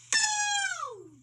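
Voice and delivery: high, eerie drawn-out boo; a ghostly boo ringing out of the dark; spooky boo. A narrator's reading. Spooky sound in story.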